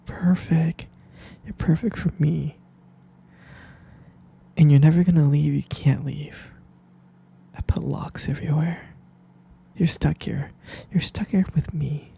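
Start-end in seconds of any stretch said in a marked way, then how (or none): none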